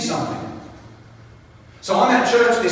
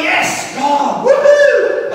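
excited cheer; 12 semitones higher, 5 dB louder, much slower than usual. Retold or imitated. Friend told he gets car.

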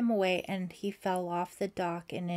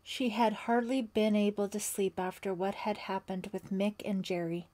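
ordinary delivery

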